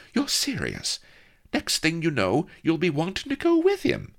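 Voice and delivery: high, mocking disbelief; incredulous maid. A narrator's reading. Maid doubting the princess, high mocking disbelief. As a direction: roll your eyes at her.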